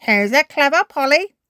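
high, loud parrot mimic; parrot repeating. A narrator's reading. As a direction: echo it like a parrot. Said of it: "repeats Polly Parrot".

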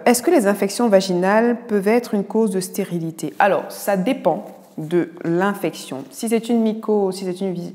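ordinary delivery

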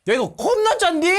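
laughing through the words; laughing, surprised it's salty. Real, not acted.

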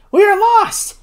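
high-pitched cry of disaster; sudden, high outcry of doom; alarmed exclamation. A narrator's reading. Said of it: "exclaimed Ferguson".

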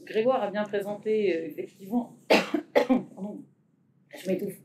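muffled behind a face mask; talking through a dusty face mask. Real, not acted. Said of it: "C'est parler derrière un masque".

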